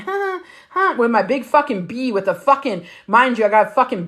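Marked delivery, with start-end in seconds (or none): 0.1-1.0 much higher awkward little laugh; mock sheepish giggle; nervous laugh. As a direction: squirm with embarrassment. Embarrassed laugh walking over.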